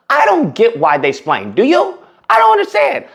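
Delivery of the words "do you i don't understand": incredulous. Real, not acted. Incredulous mock confusion.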